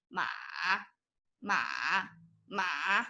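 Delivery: MA sunk low and soft; MA dipped to the bottom of the voice; third tone. Shown as a demonstration. Teacher has viewers repeat after her, low third tone. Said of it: "repeat after me".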